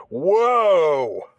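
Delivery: loud, high drawn-out whoa; surprised whoa. Real, not acted. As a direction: let out an amazed whoa. Reaction to bitter quote.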